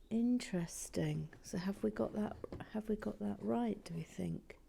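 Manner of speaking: unvoiced ASMR whisper, close to the mic; hushed building-vlog whisper; soft whisper. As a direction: murmur it as if not to wake anyone. ASMR-style Lego build chat.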